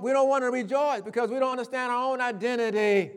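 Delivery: ordinary delivery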